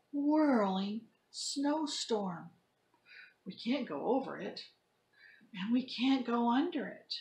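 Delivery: lively storybook read-aloud; reading to children. Acted. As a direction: act the story out for kids. Teacher reads picture book to class.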